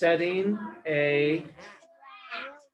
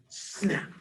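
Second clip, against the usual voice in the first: whispered snap; 2 semitones higher, 5 dB quieter than usual. A narrator's reading. Sound effect snap.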